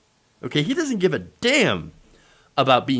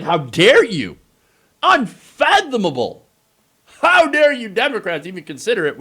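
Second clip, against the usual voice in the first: louder, high, hammed-up indignation; mock outrage; 6 semitones higher, 5 dB louder than usual. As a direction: fake fury at the Democrats.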